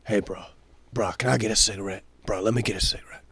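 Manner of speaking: low, soft, fast mooch voice; mooching coworker. Retold or imitated. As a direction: quietly rattle off the request. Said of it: "first night".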